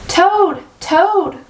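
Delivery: name called out high, falling off; understated calling cry; mild storybook cry. A narrator's reading. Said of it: "cried Frog".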